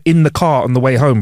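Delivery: usual speaking voice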